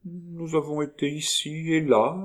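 high-pitched, airy innocence; ingenuous. A narrator's reading. Young woman answering the doctor's question, ingenuous. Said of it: "répliqua Marguerite ingénument".

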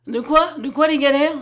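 taken aback, voice jumping up; startled retort. A narrator's reading. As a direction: throw the question back, startled. Startled reply to threat of galleys.

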